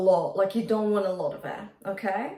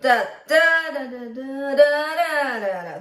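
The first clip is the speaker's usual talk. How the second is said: "Da, da" punched louder at two points in the run; emphasis on two places; 3 semitones higher, 5 dB louder, faster than usual. Shown as a demonstration. Coach marks the run's emphasis, two "da" points louder. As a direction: hit two of the "da" syllables harder, let the rest drop. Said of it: "Those two places were a bit louder".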